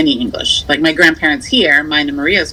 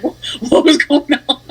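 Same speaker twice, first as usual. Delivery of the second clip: much higher, mock bewildered question; imitating husband; 10 semitones higher than usual. Wife imitates her husband baffled by her talk with her mother. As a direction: play a confused husband lost in dialect. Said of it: "He's like".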